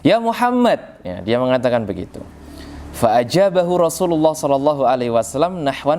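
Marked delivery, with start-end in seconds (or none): 0.0-1.0 imitating a rough shout for attention; mock shout. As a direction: yell out to him like a rude desert man. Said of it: "dengan teriakan".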